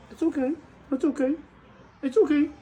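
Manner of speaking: soothing pet talk. Real, not acted. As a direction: coo it up high to calm him.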